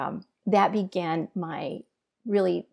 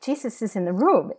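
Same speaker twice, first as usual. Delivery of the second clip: higher-pitched, wondering exclamation; retold amazement; 4 semitones higher, 4 dB louder than usual. Woman in prayer tells intercessor what she sees, amazed. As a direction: report it in astonished discovery.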